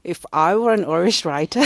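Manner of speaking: loud, hammed-up Irish brogue; a bad, laughing stab at Irish; Irish accent. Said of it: "that's my abysmal attempt at an Irish accent".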